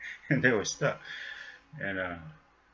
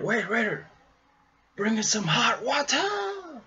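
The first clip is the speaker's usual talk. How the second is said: Bruce calling out; 13 semitones higher than usual. Retold or imitated. Bruce calling the waiter in a high voice. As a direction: stretch the call like an actor.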